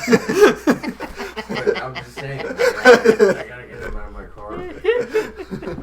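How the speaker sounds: high-pitched laugh through the words; giggling while he explains. Real, not acted. Laughing at his own excuse.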